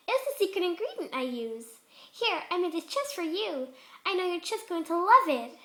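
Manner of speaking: eager and giggly, offering a treat; high excited party pony; Pinkie Pie voice. Acted.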